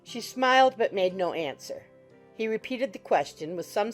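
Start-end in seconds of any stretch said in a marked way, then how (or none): none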